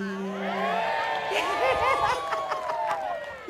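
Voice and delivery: high burst of laughter; laughing. Real, not acted. Laugh after sung joke.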